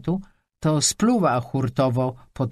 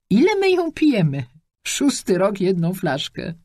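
put-on old lady's wry joke; 7 semitones higher, 4 dB louder than usual. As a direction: tease about the six-year bottle, pitched high. Old lady joking about vodka.

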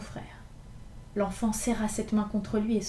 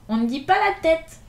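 louder and higher, a correcting shout; cry out; 7 semitones higher, 9 dB louder than usual. A narrator's reading. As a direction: bark the correction at the boy. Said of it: "cria Gavroche". (Gavroche crie au petit pour le corriger, fort et aigu)

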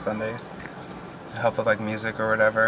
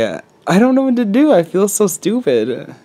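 higher and louder, rising in distress; real panic; 7 semitones higher, 8 dB louder than usual. Real, not acted. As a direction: let the fear break through. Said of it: "it's scary".

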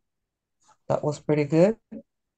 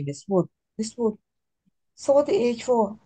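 higher, eager student voice; imitating a student; 7 semitones higher than usual. Teacher recalling a student's question, in a light mock student voice. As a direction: pipe up like a curious pupil. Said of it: "a student asking me".

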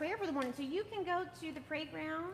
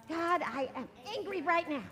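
repeated angry prayer; 4 semitones higher than usual. Shown as a demonstration. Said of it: "And we're gonna say".

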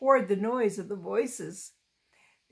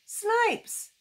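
high jeering chant; boys shouting; 10 semitones higher than usual. A narrator's reading. Boys at school taunt Laura with a high jeering chant. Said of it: "pointing and shouting".

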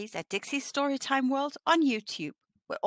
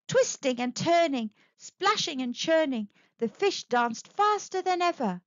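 plain reading voice

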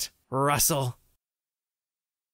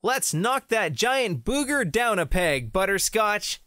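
normal talking voice

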